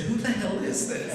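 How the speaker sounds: high-pitched retold disbelief; astounded. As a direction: act out being thrown by a baffling caller. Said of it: "I was so astounded".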